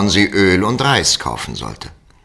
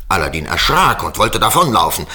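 usual speaking voice

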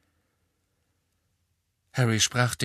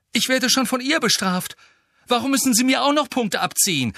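very high, indignant complaint; outraged outburst; enraged protest; 5 dB louder than usual. Acted. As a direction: protest furiously at unfair punishment. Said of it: "zornentbrannt ob dieser Ungerechtigkeit".

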